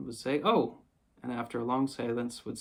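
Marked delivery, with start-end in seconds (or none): 0.4-0.8 higher, louder disappointed exclamation. A narrator's reading. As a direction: let out a disappointed cry. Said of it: "now greatly disappointed".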